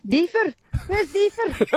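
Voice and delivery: put-on calling the dog in a Scottish accent, high and slow. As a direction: call out like a Scottish mum. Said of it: "my mum used to call it in a Scottish accent".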